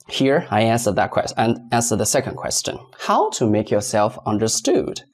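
ordinary talk

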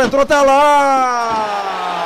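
long stretched goal cry; explosive goal commentary. Real, not acted. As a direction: hold the goal call out loud.

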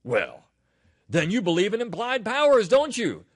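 high, loud, accusing gotcha question; challenging objector. Acted. Acts out an opponent's challenge.

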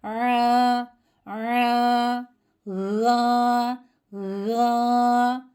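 "Ra, ra" recited with a squeezed throat and open a; throat-squeezed recitation. Shown as a demonstration. Teacher showing a letter Turkish lacks, squeezed throat. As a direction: tighten the throat on each "ra". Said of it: "boğazımızı bir miktar sıkmamız lazım".

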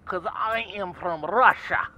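put-on slow, heavy Russian accent. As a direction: lay on a thick Russian accent. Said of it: "It is my Russian voice".

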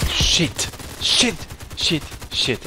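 loud, high bursts of swearing; real gamer's frustrated shouting; frustrated outburst. Real, not acted. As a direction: spit it out in sharp, separate bursts. Swearing at a game mishap.

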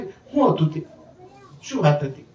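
lightly imitated nosy gossip, leaning in; mock eager curiosity. Preacher mimics listeners craving gossip, a touch nosy. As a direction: ask like a busybody hungry for news. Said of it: "આપણે આમ ઉત્સુકતાથી".

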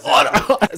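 high squeaky otter squeal through a laugh; laughing otter squeak; otter chirps. Pretend. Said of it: "Do an otter sound".

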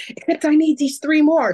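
high and loud, half-joking plea; mock greedy excitement. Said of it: "And I'm like".

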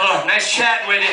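ordinary talk

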